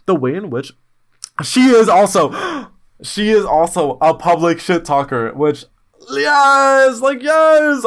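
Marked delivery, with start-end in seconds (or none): 6.1-7.9 much higher and louder, gushing; excited yes. Real, not acted. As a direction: squeal the yes with delight. Gushing over friend's shit talk.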